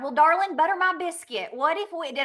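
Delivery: hammed-up Southern endearment; mock Southern. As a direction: put on a sugary Southern lilt. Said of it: "like, Southern".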